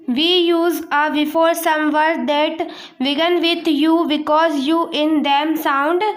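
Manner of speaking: very slow, each word spaced out; slow dictation. Shown as a demonstration. Teacher to class, dictating the rule about u slowly. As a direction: read the rule aloud for copying.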